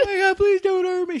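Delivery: very high, frightened squeal; mock pleading. Voicing the dog smacked by a cat, high mock-frightened pleading. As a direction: beg in a tiny high pet voice. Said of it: "She's all tough until someone smacks her".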